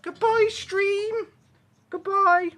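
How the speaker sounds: high, slow sing-song goodbye for the dog; cheery puppy goodbye. Pretend.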